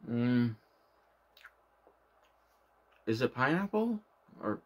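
talking while chewing; muffled by chewing; mouth full, chewing. Real, not acted. Seen 1: Cheeks bulge, closed mouth chewing throughout; normal speech in R frames.

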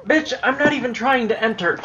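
high-pitched, affronted protest; indignant retort. Real, not acted. Player snapping back at the game's refusal to let them enter, high indignant retort. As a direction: talk back in a high, exasperated voice.

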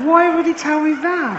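high, baffled disbelief; incredulous. Real, not acted. Comedian baffled by his friend's confession, high incredulity. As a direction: ask it in high amazement.

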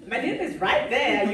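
slow, laughing protest; mock protest at a misspelled name; laughing exasperation. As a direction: laugh while pointing out the obvious. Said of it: "I'm like".

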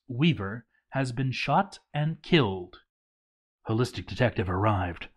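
ordinary delivery, nothing marked

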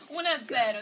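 normal talking voice

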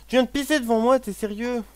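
high, loud indignant cry; outraged scolding. Real, not acted. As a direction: scold the dog in disbelief. Dog just peed in front.

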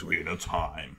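low, a touch of movie trailer voice; deep trailer narrator lightly done. Pretend. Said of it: "let me get a trailer voice".

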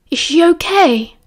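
slow, shocked, scandalised question. A narrator's reading. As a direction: ask it truly shocked.

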